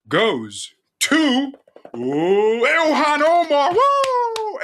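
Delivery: hammed-up gala reveal, pitch soaring high; mock-excited award show cheer; mock awards announcer. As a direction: announce the winner like a mock Oscar host.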